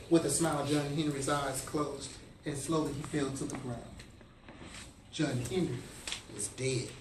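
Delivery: low, soft telling of a death; hushed, solemn. A narrator's reading. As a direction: let the voice sink quietly, with pauses. Narrating the hero's death.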